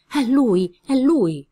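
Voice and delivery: high, loud shout of alarm; alarmed cry. Acted. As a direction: cry out that the enemy is there. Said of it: "Questo grido mandò in frantumi il silenzio".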